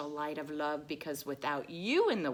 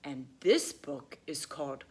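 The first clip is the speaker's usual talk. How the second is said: ordinary delivery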